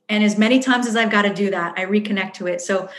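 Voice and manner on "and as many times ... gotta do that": ordinary talk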